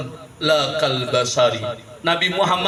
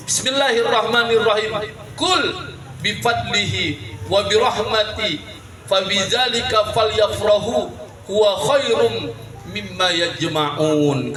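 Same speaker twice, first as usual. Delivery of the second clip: Quran recitation; 6 semitones higher than usual. Acted.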